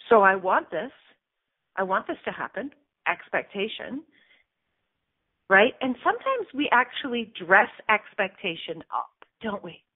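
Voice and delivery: ordinary talk, nothing marked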